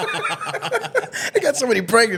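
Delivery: high laughing voice. Real, not acted. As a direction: laugh through the words.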